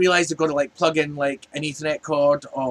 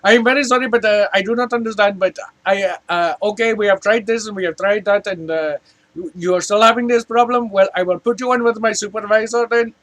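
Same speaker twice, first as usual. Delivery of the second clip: put-on higher, louder Indian-accented agent; mock Indian accent; 5 semitones higher, 5 dB louder than usual. Support agent passing caller on to supervisor, Indian accent. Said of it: "And they're like".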